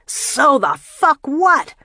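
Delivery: high, loud, slow sneering shout; contemptuous yell. Acted. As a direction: draw it out with scorn. Jamil mocks Boutros, slapping him.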